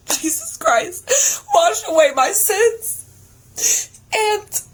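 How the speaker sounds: high, slow, sobbing cry; real sob breaking into a cry. Real, not acted. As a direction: cry it out to the heavens. Climax of her testimony.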